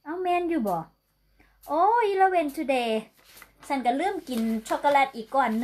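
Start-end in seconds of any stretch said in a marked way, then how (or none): 1.7-2.9 surprised realization. Real, not acted. Realizes the date.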